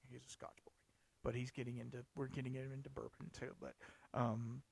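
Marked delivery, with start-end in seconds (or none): 0.1-0.7 a confiding whispered aside. Real, not acted. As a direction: whisper it, voiceless and soft. Aside about a Scotch lover.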